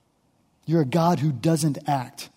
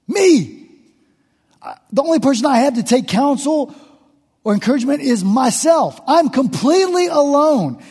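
high, loud lament of a man who feels utterly alone; a hint of desperate David pleading; anguished David; 9 semitones higher, 4 dB louder than usual. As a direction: cry it out as David, abandoned. Preacher voices David's lonely lament.

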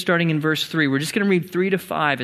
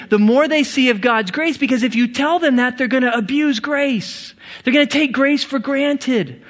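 raised, higher-pitched voice of protest; shouted objection; 7 semitones higher, 3 dB louder than usual. Acted. Grace's protector objecting to Paul's gospel, shouted objection. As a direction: object insistently, pitched up and pressing. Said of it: "this person shouts out this question as an objection".

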